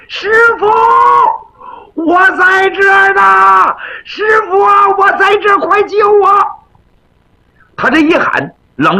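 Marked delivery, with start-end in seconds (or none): 0.1-6.3 尖着嗓子哭喊师傅救命; 扯着嗓子拼命喊，带哭腔; 高声呼救. Acted. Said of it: "他就喊开了". (踮着脚朝窗外哭喊救命)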